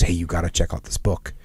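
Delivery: low, soft mumbled aside; mumbling buddy. Retold or imitated. Retelling a friend's book tip, low soft mumble. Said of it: "Plus, he's a mumbler".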